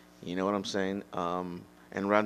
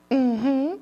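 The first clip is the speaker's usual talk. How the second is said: much higher, louder falsetto hum; mock feminine; 9 dB louder than usual. Shown as a demonstration. Demonstrating a feminine voice, high falsetto hum. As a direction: squeak a high falsetto mm-hmm. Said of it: "talk like this".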